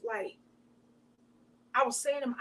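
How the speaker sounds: ordinary delivery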